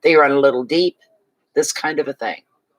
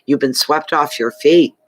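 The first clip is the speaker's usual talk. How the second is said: usual speaking voice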